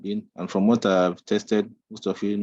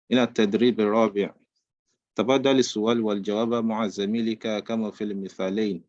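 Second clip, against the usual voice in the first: ordinary delivery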